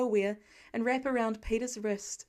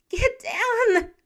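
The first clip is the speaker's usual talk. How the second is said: much higher, loud scream of warning; desperate scream; 10 semitones higher, 10 dB louder than usual. Acted. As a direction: scream it before the blast. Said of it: "The scream tears from his throat".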